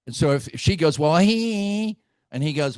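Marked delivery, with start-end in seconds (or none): 1.0-2.0 high, drawn-out whine on "he"; mock accusing wife. Wife blaming her husband to the pastor, mock accusing. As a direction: imitate a spouse pointing the finger.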